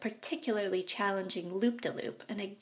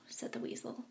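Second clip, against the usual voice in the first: plain reading voice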